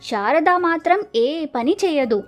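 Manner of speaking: usual speaking voice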